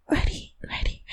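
normal talking voice

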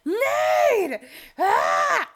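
high, loud squeezed scream; scream-whisper the high note; strained mock belt at the top. Shown as a demonstration. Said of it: "like, scream whispering it".

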